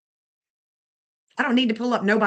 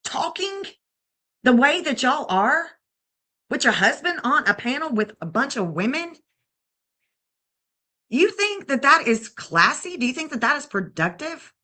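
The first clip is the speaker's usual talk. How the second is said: higher, indignant rant at Katie; talking back to Katie about the panel, indignant; heated retort; 7 semitones higher than usual. Real, not acted. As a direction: fire back, indignant and rising.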